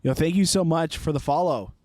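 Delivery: loud and bright thank you. Real, not acted. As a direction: belt out the thanks. Loud thanks to new follower.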